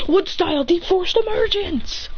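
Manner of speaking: high anime jutsu call; attack call. Acted. Hero trapping the students, high attack call.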